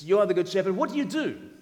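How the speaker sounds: loud, high, demanding question; insistent questioning. Acted. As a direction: press the question, pitch climbing at the end. Acted question put to Jesus.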